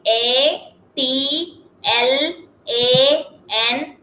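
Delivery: A T L A N spelled out very slowly; letter by letter, drawn out; slow spelling. Shown as a demonstration. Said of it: "spelling letter by letter".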